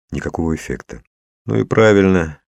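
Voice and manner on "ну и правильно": usual speaking voice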